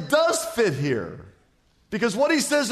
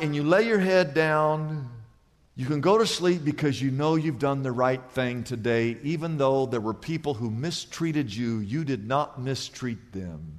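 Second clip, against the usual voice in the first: tender falling voice; 6 semitones lower, 3 dB quieter than usual. Acted. Quiet bedtime reassurance.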